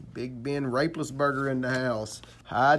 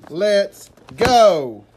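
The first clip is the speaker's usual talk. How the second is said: hype shout; 6 semitones higher, 12 dB louder than usual. Real, not acted.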